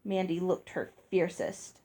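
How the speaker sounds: plain reading voice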